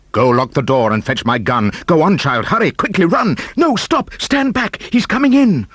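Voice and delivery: frantic warning as the crocodile comes; rising panic. A narrator's reading. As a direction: build from urgent to frantic alarm.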